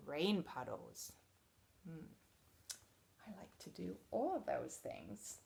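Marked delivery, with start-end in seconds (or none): 1.9-5.4 soft unvoiced whisper. Reader confiding to the kids, whispered.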